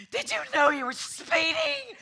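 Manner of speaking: hysterical high-pitched mock scream; wildly over-the-top yelling officer. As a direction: shriek it like an unhinged cop. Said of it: "They don't raise, they don't go".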